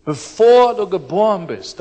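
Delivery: "bevor du geboren bist" said loud and emphatic; the whole phrase "bevor du geboren bist" pushed out as the point; stressed phrase. Shown as a demonstration. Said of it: "meine Betonung ist".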